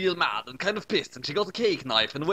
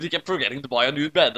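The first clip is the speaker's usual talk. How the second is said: ordinary delivery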